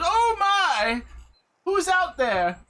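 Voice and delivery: high, loud startled call; startled old lady. Acted. Game master voices the old lady, startled by the rock. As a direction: jump at the noise and call out. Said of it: "the old lady sees it and goes".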